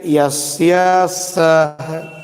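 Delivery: यस्य सह stretched out very slowly; slow teacher demo of यस्य सह. Shown as a demonstration. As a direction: drag यस्य सह out for children to copy. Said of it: "बस इधर थोड़ा सा ध्यान देना है".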